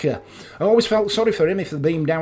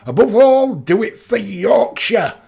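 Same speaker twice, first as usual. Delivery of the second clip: raised, louder Yorkshire rallying cry; Sean Bean; 7 semitones higher, 6 dB louder than usual. Acted. Sean Bean rallying Yorkshire in an advert, high proclaiming call. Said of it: "with Sean Bean".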